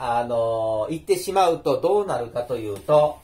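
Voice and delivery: normal talking voice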